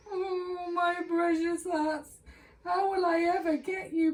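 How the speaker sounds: high, wailing, tearful plea; crying lament. Acted. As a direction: mourn the lost hats. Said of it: "began to cry".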